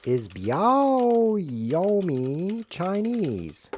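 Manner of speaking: very slow, high, stretched syllables; exaggerated slow-motion doubt; drawn-out questioning. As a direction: stretch every word into a wailing question.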